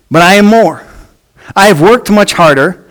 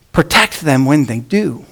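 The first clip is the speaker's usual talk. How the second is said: ordinary delivery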